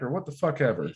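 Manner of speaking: plain reading voice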